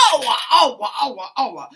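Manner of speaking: loud, high ouch cries; pained shouting. A narrator's reading. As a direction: yell out every fall. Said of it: "rief die Stiefmutter".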